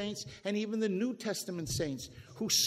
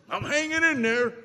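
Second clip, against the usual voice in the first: higher, louder mock weary sigh of a complainer; 7 semitones higher, 8 dB louder than usual. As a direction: parody the chronic complainer.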